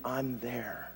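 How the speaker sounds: hushed, low voice, barely projected; soft whispering. Acted. Preacher voices God whispering to the struggling listener, hushed. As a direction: drop right down to a gentle near-whisper. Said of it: "God is whispering to you".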